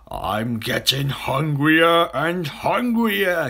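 slow, loud crocodile growing hungrier; hungry crocodile. A narrator's reading. Crocodile hiding in the bushes, slow hungry voice. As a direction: draw it out with greedy hunger.

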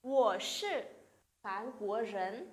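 slow, careful Mandarin answer; slow model reading. Shown as a demonstration. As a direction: spell it out slowly for learners. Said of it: "Tiếp theo".

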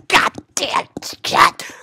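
slow, forced whispered curse; voiceless cursing. Real, not acted. Gamer cursing the fake jump, voiceless cursing. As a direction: rasp each word out without voice.

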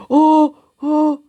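very high, loud alarmed cry; startled high shriek. Real, not acted. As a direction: shriek in panic as the enemy grabs you. Getting caught in game. (tiz, yüksek sesli şaşkın çığlık)

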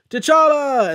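high, loud desperate call; a real burst of alarm, calling out; shouted name. Retold or imitated. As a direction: yell his name across the battlefield. Sam calls to fallen T'Challa.

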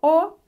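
O said high and loud; teacher emphasis; emphasized letter. Shown as a demonstration. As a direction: hit O to point it out. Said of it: "is unstressed".

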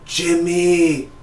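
the mother reaching out for her son, high and faint; frail mom calling. Retold or imitated. Dying mom calling for Jimmy, a lightly voiced high cry.

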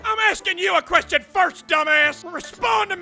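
barking drill sergeant berating a recruit; angry drill sergeant. Acted. Drill sergeant chewing out a player in the lobby. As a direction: play the foul-mouthed sergeant.

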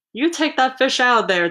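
mildly indignant crowd shout; yelled demand. A narrator's reading. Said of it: "they yelled".

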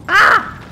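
loud, very high scream; high yelp. Acted. Player rebuffed after saying hi, loud high yelp. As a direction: let out a short, loud squeal. Yelp amid mock quarrel.

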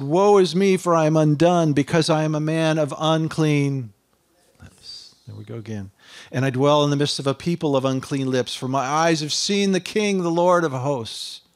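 loud, high, fervent proclamation; Isaiah crying woe is me, loud; preaching at full force. Acted.

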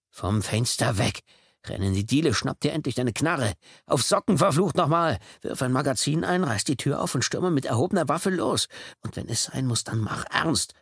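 panicking inner commander working himself up; frantic inner voice. Acted. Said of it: "Er gab sich Befehle".

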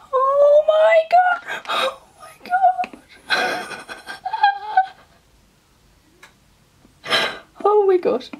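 very high, tearful gasping cries; real joyful shock, near tears; overwhelmed shock. Real, not acted. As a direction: stretch it out, squealing in disbelief through breathless laughter. Sees the positive result.

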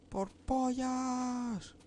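high, very stretched-out cry; drawn-out exclamation. Real, not acted. Player riffing on their own swearing, drawn-out high exclamation. Mock exclamation after "por cojones.

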